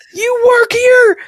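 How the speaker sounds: high, loud and slow panicked question; frantic question. Retold or imitated. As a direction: blurt it at a stranger in a rush. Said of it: "I'm go".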